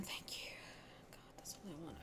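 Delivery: hushed, breathy whisper. Real, not acted. Whispering thanks for money.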